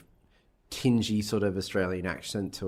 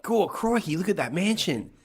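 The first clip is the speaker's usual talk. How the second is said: higher-pitched, hammed-up Australian exclamation; put-on Australian accent; 10 semitones higher than usual. Co-host voices Reginald seeing the mansion, hammed-up Australian accent. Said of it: "don't know why we're putting on this accent".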